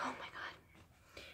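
breathless whispered awe; hushed, almost silent gasp of words. Retold or imitated. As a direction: whisper it, moved and stunned. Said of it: "And I'm like".

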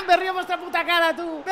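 high, loud taunt through laughter; mock them while laughing. Real, not acted. Gloating at opponents. (burla entre risas, aguda)

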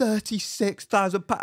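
imitating a bank worker's stunned outcry; mock shocked yell. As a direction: blurt the sum so the whole branch hears. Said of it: "that woman yell thirty-six thousand".

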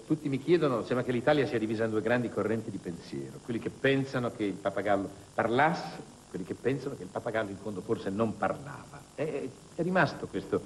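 ordinary talk

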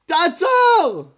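loud, high warning yell; urgent shout. Retold or imitated. A bystander warning the rafter before the falls, urgent shout. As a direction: shout him to a stop.

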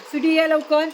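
loud call to the cat. Real, not acted. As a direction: call her in, hurry. Calling cat to come.

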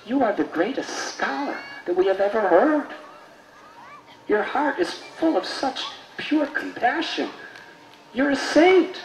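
high, excited, wondering praise; awed praise. Acted. Townspeople marvelling at the disguised saint, awed and high. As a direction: marvel at him, voice lifted high.

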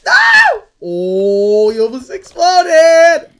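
high shriek then loud relief; shrieking alarm. Real, not acted. Gamer after a teammate nearly explodes, shrieking.

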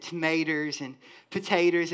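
thick country Southern pronunciation of tomatoes and potatoes; Southern accent. Shown as a demonstration. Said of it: "my Southern accent decides it wants to creep up".

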